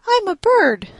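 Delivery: high pleading bat. A narrator's reading. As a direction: pitch way up for the little bat.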